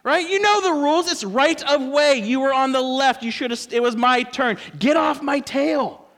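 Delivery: high, loud mock shout at another driver; indignant road-rage yelling, hammed up. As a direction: yell at the car that cut you off, half in jest. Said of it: "You're like".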